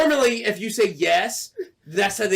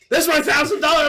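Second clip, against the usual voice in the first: loud, high-pitched complaint; outraged protest; 9 semitones higher, 5 dB louder than usual. Retold or imitated. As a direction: reenact an indignant outburst. Retelling outrage over missing money.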